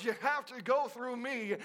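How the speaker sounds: ordinary talk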